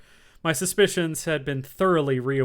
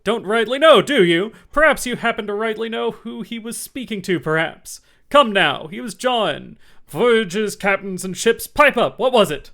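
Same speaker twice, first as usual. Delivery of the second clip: loud, high, scornful; mock his answer and demand more; mocking bully; 8 semitones higher, 10 dB louder than usual. A narrator's reading. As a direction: Silver mocking Morgan's excuse. Silver mocks and bullies.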